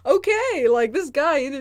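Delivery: loud, high mock exasperation; exasperated outburst. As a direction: throw up your hands at this guy. Exasperated at the doctor.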